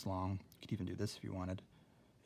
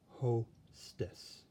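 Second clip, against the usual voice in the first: "Hostess" split slowly into "Ho" and "stess"; "Hostess" in two separated syllables; syllable break; 2 semitones higher than usual. Shown as a demonstration. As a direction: draw out "Ho", pause, then finish "Hostess". Demonstrates word split by syllable.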